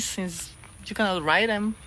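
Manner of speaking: normal talking voice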